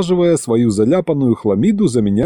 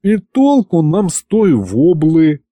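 slow, higher let-down complaint; disappointed drawl; 6 semitones higher, slower than usual. A narrator's reading. Aristophanes dismissing the fish discovery, disappointed drawl. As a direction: drag the words out, unimpressed by the news. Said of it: "разочарованно протянул Аристофан".

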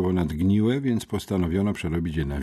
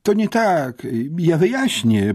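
much higher, louder panicky schoolboy; he tries to get a word in, desperate; boy protesting; 10 semitones higher, 5 dB louder than usual. A narrator's reading. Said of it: "próbowałem sprostować". (chłopiec protestuje, wysoko i głośno)

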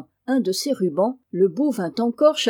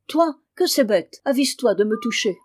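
angry outburst; 3 semitones higher, 4 dB louder than usual. Acted. Boy furious at friend tickling him, angry warning. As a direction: sneer it at him, then warn him off.

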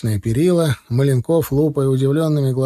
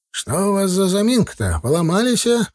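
higher-pitched questioning call; lightly voiced old driver; 8 semitones higher than usual. A narrator's reading. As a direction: call over with a lifted, questioning voice. Old driver calls out from behind. (слегка изображая старого шофёра, выше голосом)

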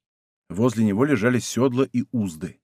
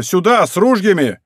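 raised, urgent call to arms; loud shout; 5 semitones higher, 5 dB louder than usual. A narrator's reading. Steward calling for men with guns, shouting loudly. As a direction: summon armed help at high volume. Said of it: "громко закричал управитель".

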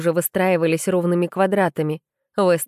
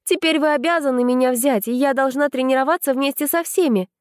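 higher, indignant protest; 7 semitones higher than usual. A narrator's reading. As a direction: press the demand, outraged. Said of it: "продолжила возмущаться я".

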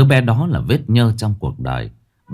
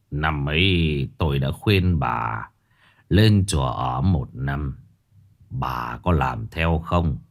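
low, slow, soft fortune teller's lament; thầy bói than thở; 6 semitones lower, 4 dB quieter, slower than usual. A narrator's reading. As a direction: sigh it out as the old master, slow. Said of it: "than thở". (giọng thầy bói trầm, chậm, than thở)

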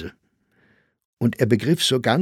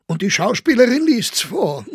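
much higher astonished disbelief; wondering; 14 semitones higher, 5 dB louder than usual. A narrator's reading. Grossinger wonders aloud that the actress reads it. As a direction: marvel at it. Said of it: "sagte Grossinger verwundert".

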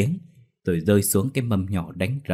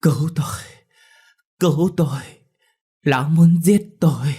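high, weak strained cry for help; injured woman begging, broken by pauses; feeble, gasped plea; 8 semitones higher, 5 dB louder than usual. A narrator's reading. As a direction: eke out each phrase with failing strength. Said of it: "yếu ớt mà thều thào".

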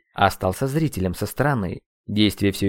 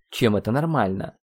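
usual speaking voice

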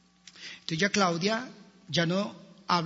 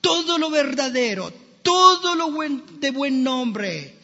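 higher and louder, each "todo" hit hard; preached scripture; 7 semitones higher, 3 dB louder than usual. Acted. Pastor proclaims the Bible verse to the church, fervent and loud. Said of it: "Por eso dice".

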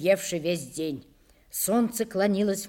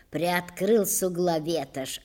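ordinary delivery, nothing marked

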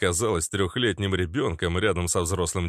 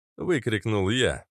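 plain reading voice

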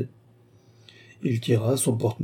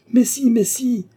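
much higher-pitched, insistent cry; eager exclamation; 12 semitones higher, 4 dB louder than usual. A narrator's reading. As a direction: burst in with an eager contradiction. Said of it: "s'écria-t-il". (exclamation vive, très aiguë)

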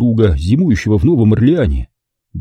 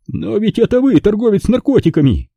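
high-pitched incredulous accusation; shocked outcry; 12 semitones higher than usual. A narrator's reading. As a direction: blurt out the accusation. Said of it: "тоже выкрикнул это вслух".

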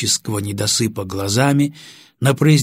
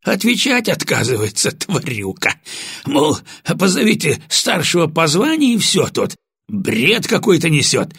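slightly out of breath; 6 semitones higher, 3 dB louder than usual. Acted. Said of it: "слегка запыхавшимся голосом сообщил сержант".

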